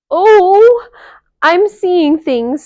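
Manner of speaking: high, loud, slow squeak; frightened squeak. A narrator's reading. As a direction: squeak in shock. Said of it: "squeaked Annie suddenly".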